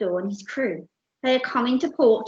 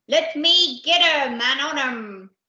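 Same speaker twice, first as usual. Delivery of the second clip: loud, slow, raised pirate growl; raging pirate captain; 4 semitones higher, 6 dB louder than usual. Acted. Captain wanting at his attackers, loud growling roar. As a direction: roar it out, spoiling for a fight.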